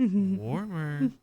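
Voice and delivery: put-on high, loud ghostly child whisper-call; haunting voice. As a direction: call out like a ghost child luring her closer. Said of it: "a little ghostly child voice says".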